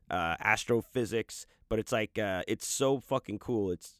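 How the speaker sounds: usual speaking voice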